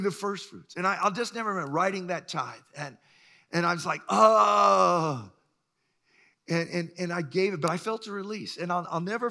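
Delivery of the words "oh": loud sudden oh of realization; startled realization. Retold or imitated. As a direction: blurt it as it dawns. Realizing he forgot the tithe.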